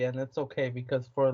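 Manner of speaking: ordinary talk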